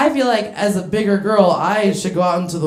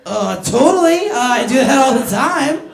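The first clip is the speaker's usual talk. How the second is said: high, loud eager put-on voice; naive young woman; 4 semitones higher, 5 dB louder than usual. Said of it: "young enough that she's like".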